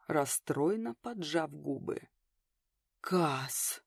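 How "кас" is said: breathed out. A narrator's reading. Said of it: "выдохнул Феникс".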